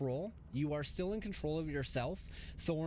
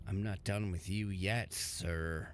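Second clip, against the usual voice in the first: low, menacing possessor voice; menacing ghost; 6 semitones lower than usual. Acted. GM voicing possessor Thorn.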